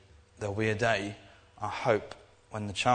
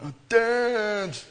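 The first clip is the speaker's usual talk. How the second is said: put-on much higher, a groaning teenage whine; whiny teenager; 13 semitones higher, 8 dB louder than usual. Teenager groaning at dad over lost pocket money, high whine. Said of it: "that's my teenager impression".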